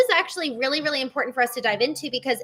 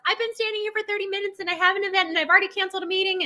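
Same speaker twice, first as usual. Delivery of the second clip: high, rushed self-justification; acted-out inner protest, pitched up and hurried; defensive protest; 8 semitones higher, much faster than usual. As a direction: plead your case fast in a higher voice. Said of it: "this desire to defend myself".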